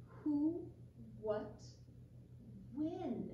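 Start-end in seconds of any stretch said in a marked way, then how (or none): none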